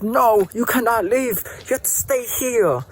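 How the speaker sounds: forbidding dad. Acted. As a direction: tell your son he can't go. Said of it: "his dad's like".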